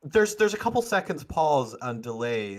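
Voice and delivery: ordinary talk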